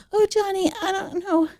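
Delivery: high, weepy mock of herself; teary Teri Garr. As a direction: whimper it like a flustered starlet. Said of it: "Terry Garr".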